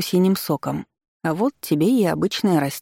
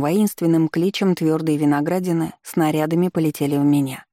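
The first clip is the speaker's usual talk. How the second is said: ordinary delivery, nothing marked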